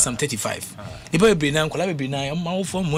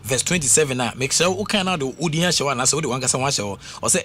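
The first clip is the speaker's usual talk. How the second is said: normal talking voice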